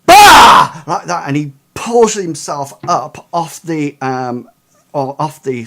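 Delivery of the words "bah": sudden, much higher and much louder "Bah!"; monster roar. Acted. GM voicing the strapped corpse lunging, a sudden roar. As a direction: burst out at them like a waking beast. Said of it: "And the guy goes".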